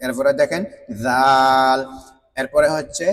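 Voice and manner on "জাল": জাল stretched long, tongue at tooth tips; slow, isolated জাল; demonstrating articulation. Shown as a demonstration. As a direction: hold the vowel in জাল on one level note. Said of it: "আগার সঙ্গেই লাগাইয়া".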